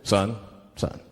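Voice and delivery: low, measured cop voice; police officer. Acted. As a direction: pull someone over with a deep authoritative tone. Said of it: "Maybe the police pull me over".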